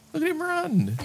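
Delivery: put-on squeaky high baby talk for the little runner; delighted cutesy squeak. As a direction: coo it up high like at a pet. Cooing at a running creature.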